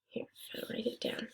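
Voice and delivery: a soft unvoiced aside; murmured under the breath as you write; whispered aside. Real, not acted. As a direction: whisper it to yourself while writing. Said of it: "unvoiced aside while writing".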